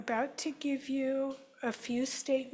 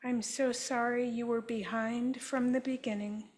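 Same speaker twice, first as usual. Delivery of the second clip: guided healing affirmation. Acted. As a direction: offer each apology gently, like a therapist. Leads group in healing statements.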